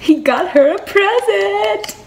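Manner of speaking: high, loud, drawn-out squeal with a laugh; gushing wail. Real, not acted. Fan melts over hero's gift, gushing drawn-out squeal. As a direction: wail it, overcome. Overcome by romantic scene.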